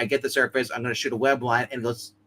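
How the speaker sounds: ordinary delivery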